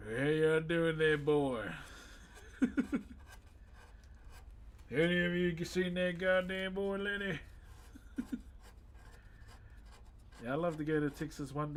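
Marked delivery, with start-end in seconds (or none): 0.1-1.7 loud, playful Southern US drawl; put-on southern twang, raised volume; southern accent. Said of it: "with that southern accent". 4.9-7.4 playful put-on southern twang; southern accent. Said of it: "with that southern accent".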